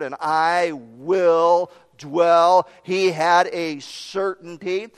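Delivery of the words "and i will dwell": "I will dwell" very slow and firm; certain David. Retold or imitated. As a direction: stretch "dwell" with assurance. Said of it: "No uncertainty in the tone of David".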